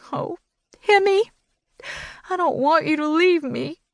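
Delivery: high, tearful plea; high tearful cry to a dying dog. A narrator's reading. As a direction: sob it out, begging the dog to stay. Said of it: "I cried".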